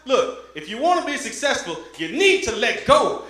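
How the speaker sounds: high, loud mock-preacher father; preachy dad. Dad to son about college money, loud and preachy. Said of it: "my dad's ... religious nut".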